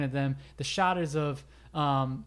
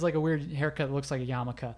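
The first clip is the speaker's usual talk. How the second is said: ordinary delivery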